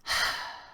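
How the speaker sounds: forceful sigh through the mouth; hard breath out. Shown as a demonstration. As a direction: push the air out hard. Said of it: "So it should sound a little bit like this".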